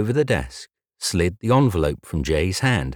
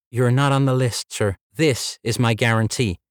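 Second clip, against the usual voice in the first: lightly voiced polite receptionist, higher; a hint of a bright front-desk girl, pitched up; 6 semitones higher than usual. Red-haired receptionist greeting Jay, lightly higher polite voice.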